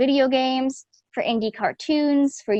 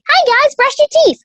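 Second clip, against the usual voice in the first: put-on high, quick little girl voice; chirpy tiny kid character; small girl voice; 7 dB louder, faster than usual. Shown as a demonstration. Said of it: "really small female voices that are like".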